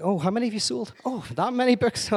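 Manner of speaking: high, forced admiration; sarcastic awe at his sales; mock envy. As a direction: fake being impressed by a rival. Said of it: "it's like".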